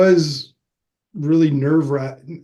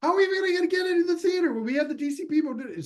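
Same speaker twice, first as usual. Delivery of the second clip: fast, high panicked babble; mock freakout, rapid and high; frantic rush; 3 dB quieter, much faster than usual. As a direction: tumble the words out in a panic. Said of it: "We're like".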